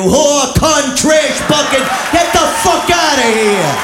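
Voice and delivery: loud, furious insult shout; angry shouted insults. Retold or imitated. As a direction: spit the insults out at her.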